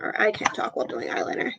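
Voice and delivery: fast whisper while concentrating; applying eyeliner. Real, not acted. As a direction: whisper it, eyes on the mirror. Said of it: "Sorry, I'm not talking".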